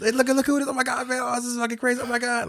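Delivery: very high, rushed mock excitement; squeaky retold freak-out; imitating frantic fan. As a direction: breathlessly show off a celebrity to a friend, hammed-up. Retelling excited fan on FaceTime.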